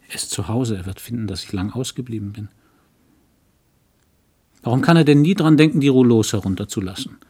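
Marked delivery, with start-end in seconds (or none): 4.6-7.2 higher, louder, fast burst of irritation; a quick flare of vexation at her husband; exasperated outburst. Acted. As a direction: snap it out in sudden annoyance. Exasperated thought about her husband.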